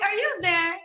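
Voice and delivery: calling to her reflection. Retold or imitated. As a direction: knock on the mirror asking if anyone's home. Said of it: "I'm like".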